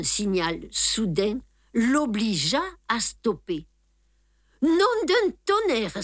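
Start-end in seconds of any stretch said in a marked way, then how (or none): 4.6-6.0 higher, louder sudden cry; sudden exclamation. Acted. Poirot realises the trick, crying out higher and louder. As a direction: burst out in alarm. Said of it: "s'écria Poirot tout d'un coup".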